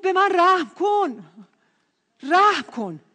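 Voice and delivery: loud, high, drawn-out plea; imitated beggar calling out; retold cry for mercy.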